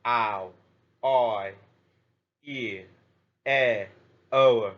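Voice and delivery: "ow", "oi", "e", "e", "o" each said alone with a clear glide; teacher listing pure diphthongs aloud; sounding out diphthongs. Shown as a demonstration. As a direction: spell out each sound for learners. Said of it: "Five diphthong pure English sounds are".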